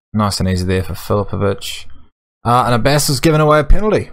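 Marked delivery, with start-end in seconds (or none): 2.4-4.1 higher, louder and fast; alarmed outburst. Real, not acted. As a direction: blurt it out in alarm. Penalty conceded in match.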